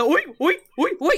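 short, high seal yelps; playful mock seal barks; seal barking. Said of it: "They do seal barking".

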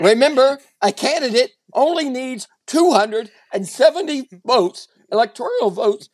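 patronizing anchorman lecture, emphatic and over-enunciated; talking down. Acted. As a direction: explain it as if to a moron. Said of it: "he talks to you like you're a moron".

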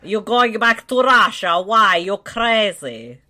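a hint of a Russian accent; lightly put-on Russian accent, slow at the end. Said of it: "Am I doing a Russian accent?".

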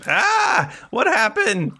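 high yelp, then high goblin voice; startled goblin. Acted. Goblin wakes up tied, startled high creature voice. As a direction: come to with a squeal.

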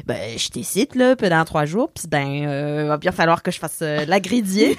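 a hint of québécois twang on pis ben and gridier; québécois accent. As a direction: imitate a Québec guy settling into a chalet alone in a québécois accent. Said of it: "il parle en, un québécois".

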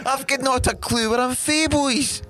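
high put-on Scots; hammed-up, high-pitched Scottish accent; muddled Scottish accent. Shown as a demonstration. Baffled Scot unsure where he's from, high Scottish accent. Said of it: "about five different Scottish accents".

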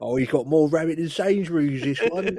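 high, loud East London banter; hammed-up East London gossip; East London accent. Joining the mock East London bit.

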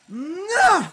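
high effortful strain cry; straining grunt. Acted. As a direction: struggle hard against straps. Straining against the straps.

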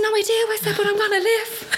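high, laughing relief; elated. Retold or imitated. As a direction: laugh out the good news. Telling mum she'll live.

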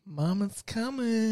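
high, slow American mum echo; American accent. Retold or imitated. Co-host echoing the woman's American accent. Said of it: "the American accent came from".

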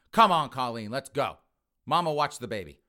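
brusque, loud mock command; loud orders. Son leaving with girlfriend, loud orders. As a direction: call it out as you storm off.

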